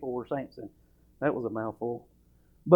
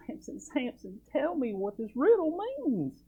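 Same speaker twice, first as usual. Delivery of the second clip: high, slow whiny begging; mock weeping plea; 11 semitones higher, slower than usual. Preacher mimics Samson's wife begging through tears. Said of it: "his wife comes to him crying and weeping and says".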